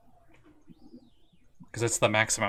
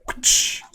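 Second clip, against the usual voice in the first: whip crack noise; 8 dB louder than usual. Acted.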